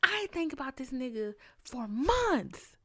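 high, loud exasperated rant; mock-furious rant at her own brain; scolding own brain. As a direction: yell at your brain in disbelief. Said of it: "I'm like".

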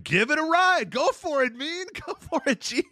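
high mock crowd cheer, laughing through it; mock cheering fans. Host mimics eighties fans egging Mean Gene on, high mock cheer. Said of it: "The fans are behind Mean Gene going".

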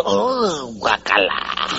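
mock revolted body; disgusted yuck. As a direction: drop from a sharp oh to a low groan of disgust. Body reacting to first cigarette.

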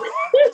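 sudden high laugh; high burst of laughter. Real, not acted. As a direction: let out a loud laugh. Laughs at wife's insult.

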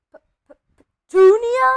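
stammered gasp bursting into a high, loud cry; stutter, then blurt the name in alarm; gasping shock. A narrator's reading. Uncle calling his wife over a letter, gasping. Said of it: "he gasped".

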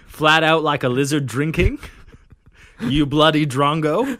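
put-on loud laughing Aussie accent; broad Australian accent. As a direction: ham up the Australian slang. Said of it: "dubbed this accent Aussie".

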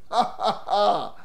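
open, rhythmic ha-ha laugh. Real, not acted. Preacher reacting to the mother hiding her son, laughing. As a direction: laugh out in disbelief.

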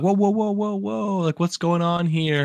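high, drawn-out mock-alarmed whoas; alarmed protest. Survivor with hands up to gunmen, high alarmed protest. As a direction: act out pleading for everyone to back off.

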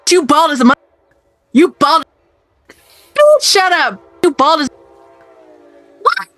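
high, yelled imitation of the passenger on the phone; mock angry passenger. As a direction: ham up the rude guy shouting at his cousin. Retelling passenger's phone call.

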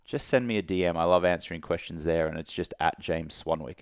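ordinary talk, nothing marked

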